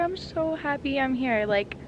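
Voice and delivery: usual speaking voice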